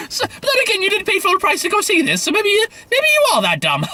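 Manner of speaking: very high squeaky parody voice; hammed-up high-pitched mockery; mock condescension. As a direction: talk down to the audience in a silly falsetto. Parody of trailer talking down to audience.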